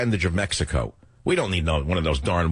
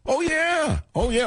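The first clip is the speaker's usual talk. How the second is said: sarcastic oh yeah; slower than usual. Acted. Sarcastic reaction to headline.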